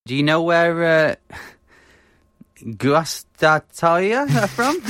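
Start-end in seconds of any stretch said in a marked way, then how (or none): 2.6-4.8 slow careful. Real, not acted. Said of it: "I struggle to pronounce some things".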